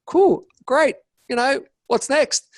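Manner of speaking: high, breezy so-what voice; mock cheery shrug. To himself after a goal, a high breezy shrug. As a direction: toss it off lightly, already bored.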